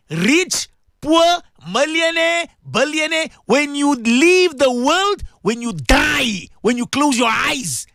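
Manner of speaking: high, urgent sermon voice with long drawn-out stresses; fervent preaching. Acted. Radio host preaching to his beloved listeners, fervent sermon. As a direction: preach it hard to the listeners, pleading and warning.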